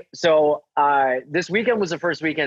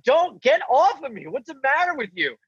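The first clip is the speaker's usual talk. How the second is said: shrieking protest, mock panic; high panicked plea; 11 semitones higher than usual. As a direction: fend off the attacker in mock panic. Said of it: "And I'm like".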